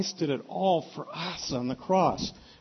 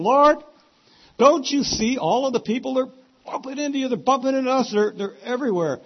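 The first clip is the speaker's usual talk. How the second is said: high, loud incredulous protest; acted exasperation, pitched up high; exasperated disciples; 11 semitones higher, 8 dB louder than usual. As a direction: plead loudly, as if stating the obvious. Voices exasperated disciples answering Jesus.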